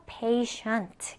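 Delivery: "patient" as PAY-shunt, middle sound a clear sh. Shown as a demonstration. Teacher explains the silent T, "patient" said with sh. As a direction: give "patient" a sh for its "ti". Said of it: "슈 발음을 해서".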